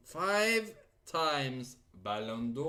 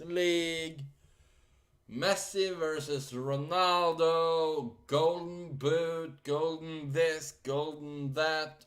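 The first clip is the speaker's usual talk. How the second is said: excited; 5 semitones higher, 4 dB louder than usual. Real, not acted. Listing a star's trophies excitedly.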